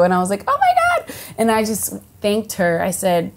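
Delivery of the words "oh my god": high squeal of disbelief, much higher pitch; starstruck gasp. Retold or imitated. To Salma's promise, a high starstruck squeal. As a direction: let it shoot up high in amazement.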